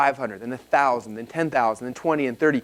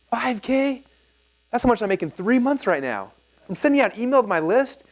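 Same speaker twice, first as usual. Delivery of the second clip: high-pitched, amazed at the sum; retold excitement, pitched well up; excited realization; 9 semitones higher than usual. Speaker recalls his naive money math, excited and high.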